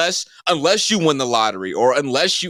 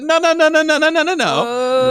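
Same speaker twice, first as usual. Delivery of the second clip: mock frantic refusal; 12 semitones higher than usual. Storyteller mocks his own refusal to the salesman, squeaky and high. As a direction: rattle off the no's in a squeaky panic. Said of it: "I said".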